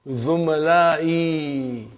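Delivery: Zumalai drawn out slowly, syllable by syllable, falling at the end; slow drilled word. Shown as a demonstration. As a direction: spell out Zumalai slowly for the class. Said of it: "We are talking about third person".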